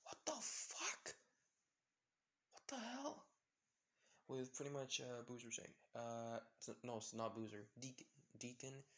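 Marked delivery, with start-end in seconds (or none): 0.0-3.3 high, slow stunned disbelief; shocked outburst. Acted. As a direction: gasp it out, bike is gone. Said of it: "He was like".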